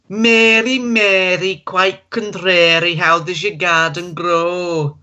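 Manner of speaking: put-on sing-song northern accent, slow and lilting; northern English accent. Contestant on a talent show reciting in a northern English accent. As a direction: recite the rhyme as a broad northerner. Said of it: "here we go, northern".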